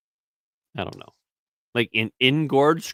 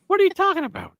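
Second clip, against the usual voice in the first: high defensive squeak; 3 dB louder, faster than usual. Real, not acted. Repeats the high protest.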